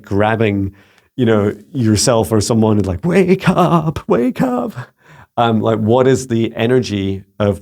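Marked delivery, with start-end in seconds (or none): 3.0-4.7 high, rising urgent call; urgent wake-up call. Pretend. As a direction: shake them awake with your voice. Earth shaking you awake.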